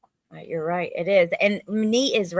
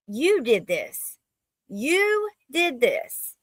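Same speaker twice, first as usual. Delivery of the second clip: slow accusation climbing much higher; accusing; 5 semitones higher, much slower than usual. Real, not acted. Host blaming the killer for his crime, slow rising accusation. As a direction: drag out the blame, rising in pitch.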